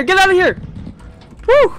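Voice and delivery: high panicked screaming. Real, not acted. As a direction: scream in terror. Monster attacks, screaming.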